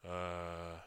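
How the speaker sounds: low, soft, drawn-out depressed mumble; mock a listless comic stalling on the mic; Joe Reddington impression. Comic mimicking a fellow comic on stage, low depressed mumbling. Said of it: "here's my impression of Joe Reddington".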